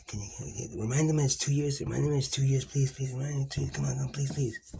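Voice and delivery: hushed pleading, fading to a whisper at the end; quiet urgent nudge from God; God nudging him. Retold or imitated. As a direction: softly beg him to remind the listener, over and over. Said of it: "I got God pushing me on the shoulder".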